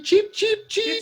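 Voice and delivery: high laughing chicken chirp; chicken noises. Retold or imitated. Joining the chicken taunt, high chirping. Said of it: "Are you chicken?".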